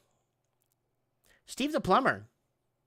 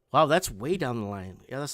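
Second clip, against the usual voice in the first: usual speaking voice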